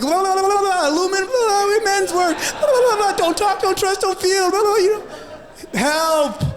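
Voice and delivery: very high, frantic la la babble with laughter; flustered nonsense singsong; mock panicked high babble; panicked babbling.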